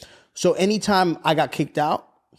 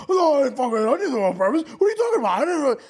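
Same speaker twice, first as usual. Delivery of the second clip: high, mock indignant denial; imitated arguing; 8 semitones higher, much faster than usual. Stepdad denying it to mom, mock arguing. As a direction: protest innocence like a cornered stepdad. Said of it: "he's, like, arguing".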